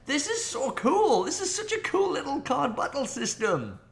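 gushing with delight; excited. Real, not acted. Excited about the game.

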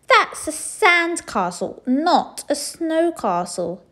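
high, loud, slow cross retort; snapping. A narrator's reading. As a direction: snap it back crossly. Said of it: "she snapped".

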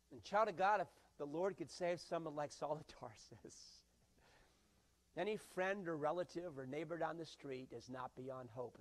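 ordinary delivery

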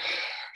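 wife's big sigh, acted out. Wife giving up on arguing with husband, big retold sigh. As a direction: let out a long, audible imitated sigh. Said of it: "Big sigh".

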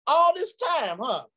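high, drawn-up mock astonishment; imitated teacher's exclamation. Teacher catching his young imitator at the drum, high mock astonishment. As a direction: pitch it way up, calling him out.

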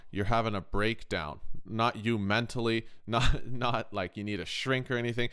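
ordinary talk

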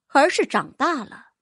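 ordinary delivery, nothing marked

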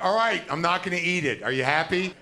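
loud, exasperated snap; fed up at lunch with a vegan, loud and exasperated. Retold or imitated. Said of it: "I'm gonna be like".

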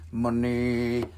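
drawn-out echo. Pretend.

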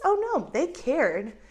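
ordinary talk, nothing marked

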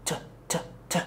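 "ت" as a bare aspirated puff with no voice; strong t. Shown as a demonstration. Teacher showing the strong English t. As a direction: spit out "ت" as a strong breathy t. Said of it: "تي قوية".

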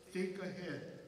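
ordinary talk

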